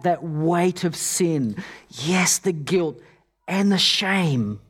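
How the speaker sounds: normal talking voice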